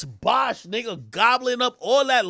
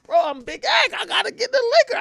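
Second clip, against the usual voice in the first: high, loud excited quote; hyped-up demand; 13 semitones higher, 4 dB louder than usual. Retold or imitated. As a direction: act out clamoring for a drink, voice shooting up. Acting hyped demand for liquor.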